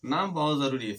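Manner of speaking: ordinary talk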